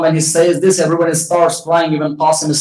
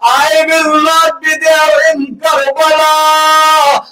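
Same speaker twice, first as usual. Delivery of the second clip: mourning sermon lament; 8 semitones higher, slower than usual. Acted. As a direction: preach the tragedy as a rising cry of grief. Majlis lament builds to climax.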